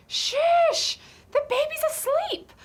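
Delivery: high, loud scolding shush; sharp loud shush. Pretend. Babysitter loudly hushing the dogs because the baby sleeps. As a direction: snap at them to stop the noise. Telling the noise to stop.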